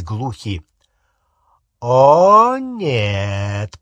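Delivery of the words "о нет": acted patronizing denial; condescending interrogator. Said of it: "произнесла Амбридж".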